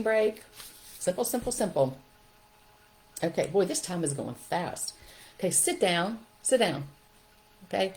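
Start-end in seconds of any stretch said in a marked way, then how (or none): none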